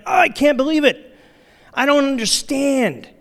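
high-pitched, exasperated venting; exasperated parent. Acted. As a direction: vent in disbelief like a fed-up parent. Exasperated parent's inner rant, acted.

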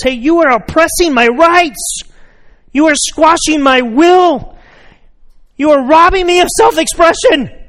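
high, loud hammed-up protest of a child; mock child yelling. Kid yelling at parent leaving the playground, hammed-up high yell. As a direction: ham up a kid's outraged yell. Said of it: "That's what they're yelling".

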